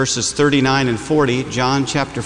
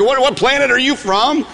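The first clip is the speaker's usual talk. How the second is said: much higher astonished question; mock shock; 9 semitones higher than usual. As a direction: act stunned by the roommate. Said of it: "I'm like".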